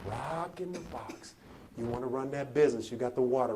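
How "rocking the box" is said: very soft, low, intimate refrain; hushed preaching. Acted. Preacher likens the crowd to Johnny, hushed refrain. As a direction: bring it right down to the listener.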